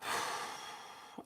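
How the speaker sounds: long unvoiced heavy sigh. Real, not acted. Sighs heavily before confessing she is sad. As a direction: let out a deep, weary breath. Emotional pause before admitting sadness.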